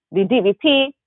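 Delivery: put-on traffic report in a West Indian accent. Journalist imagining herself on air with her accent, West Indian accent. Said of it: "naturally go in and out of an accent".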